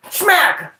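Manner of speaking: high, explosive smack; slap sound effect. Acted. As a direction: punch it out like a slap landing.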